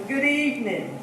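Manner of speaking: ordinary talk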